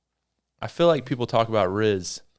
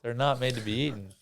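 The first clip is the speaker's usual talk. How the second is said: usual speaking voice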